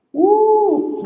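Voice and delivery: high, loud joyful whoop; excited whoop. Real, not acted. Teacher cheering a student's great sentence with a whoop.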